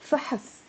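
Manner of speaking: فحص said softly as one syllable; فحص with barely a vowel after ح. Shown as a demonstration. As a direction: run فحص together, weight on fa. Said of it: "It's a one syllable word".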